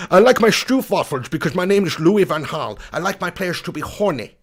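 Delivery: hammed-up generic Dutch accent; Louis Van Gaal. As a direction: do a comic Dutch manager with slushy esses. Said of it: "It's generic Dutch accent".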